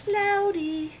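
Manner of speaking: usual speaking voice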